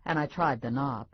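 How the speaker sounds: ordinary delivery, nothing marked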